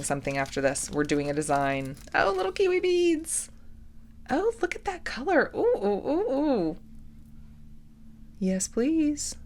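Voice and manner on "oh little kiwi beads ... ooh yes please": high excited squeal. Real, not acted. Delighted by new beads.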